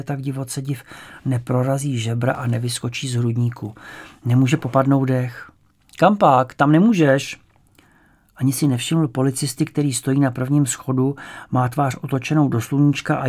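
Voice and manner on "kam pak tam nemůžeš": stern policeman. A narrator's reading. Policeman stopping the boy.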